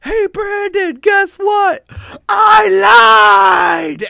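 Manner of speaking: very high, loud taunting shout; gleeful high-pitched holler, acting out the wrestler; imitated yell. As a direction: yell it out, building to the last words. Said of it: "And then he just yells".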